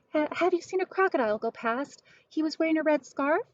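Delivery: high, hurried, fretful questions; frantic asking. Acted. Missus Prim asking the lady about Lyle, frantic. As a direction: rush the worry out.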